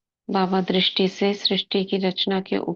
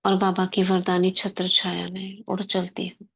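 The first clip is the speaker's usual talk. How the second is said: guided meditation. Acted. Sister guiding the class into soul-consciousness before murli, meditative.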